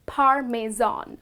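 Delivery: parmesan as PAR-meh-zahn; correct parmesan. Shown as a demonstration. Teacher modelling the cheese name, zahn ending. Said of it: "The correct pronunciation of this word is".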